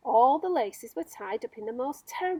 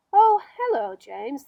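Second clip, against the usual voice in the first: much higher, louder bright greeting; cheery glowworm; 3 semitones higher, 10 dB louder than usual. Acted. Glowworm greeting James from the ceiling, high and cheery. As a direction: sing out a friendly hello.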